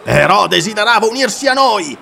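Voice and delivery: heated reply; retorting. Acted. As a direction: fire back at Aratus. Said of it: "replicò il corintiano".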